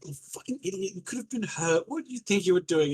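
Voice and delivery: frightened anger turned into a lecture; worried scolding. Acted. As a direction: scold him because he could have been hurt. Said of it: "she's like".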